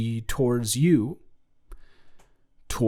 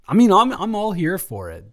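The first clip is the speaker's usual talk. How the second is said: excited; 8 semitones higher, 7 dB louder, faster than usual. Acted. Gushing reply in love scene.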